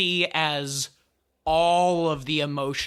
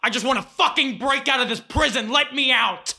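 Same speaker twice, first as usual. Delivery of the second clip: fast, high, raised outburst; furious, pitched-up rant; angry; 9 semitones higher, 4 dB louder, faster than usual. Acted. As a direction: rage to break free. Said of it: "as the angry version just goes".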